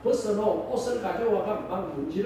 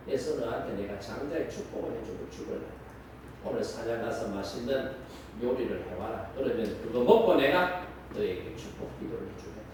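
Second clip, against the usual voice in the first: put-on low, soft voice of an old, dying father; frail elderly patriarch, lower and quieter; old father Isaac; 10 semitones lower, 4 dB quieter than usual. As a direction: speak low and hushed like a frail old man giving his last wish. Dying old Isaac blessing his son.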